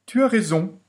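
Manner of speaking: the fox replies to Tom, high and sly; fox's voice. Acted. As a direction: answer slyly as the fox. The fox answers.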